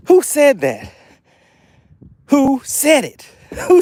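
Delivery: gloating challenge. Real, not acted. Streamer taunting chat doubters, gloating. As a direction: rub it in to the doubters.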